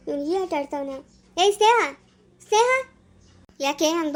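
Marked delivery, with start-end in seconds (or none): 1.4-2.7 loud call across the room; calling out loudly to a weeping sister. Acted. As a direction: shout her name to get her attention.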